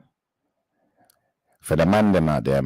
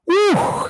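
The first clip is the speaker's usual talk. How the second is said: a sharp high, louder woo to reset; calming exhale; 4 dB louder than usual. Real, not acted. Taking a minute after the video, sharp high woo. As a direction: let out a high woo to compose yourself. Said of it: "give myself a minute to just, like".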